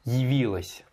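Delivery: Явилась with "yi" at the start; corrected pronunciation. Shown as a demonstration. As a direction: model Явилась as "yi-vilas". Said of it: "Et tu dis yi". (Явилась prononcé « yi-vilas »)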